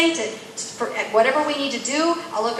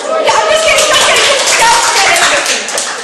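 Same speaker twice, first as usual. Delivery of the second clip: high, loud, laughing; giddy, loud backpedal over applause; laughing disclaimer; 11 semitones higher, 10 dB louder than usual. Real, not acted. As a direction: shout it through real laughter. Joking after asking audience for applause.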